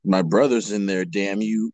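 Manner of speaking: low, understated dramatic read; character reading. Acted. Reads line low before parody.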